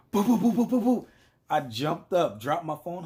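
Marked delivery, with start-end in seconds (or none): 0.1-1.1 loud, high rapid-fire knocking noise; imitated door banging. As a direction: mimic frantic banging on the door. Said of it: "I hear a bang on the door".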